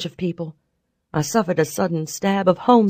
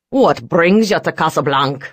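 louder rough boss voice; gruff man voice; 3 dB louder than usual. Acted. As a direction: speak as a rough crime boss. McCabe questions them.

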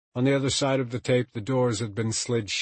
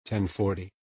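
ordinary delivery

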